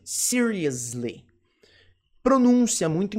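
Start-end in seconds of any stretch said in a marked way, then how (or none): 0.0-1.2 "seriously" slowly, low, syllable by syllable; drawn-out, careful "seriously"; slow pronunciation model. Shown as a demonstration. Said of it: "Só fala assim".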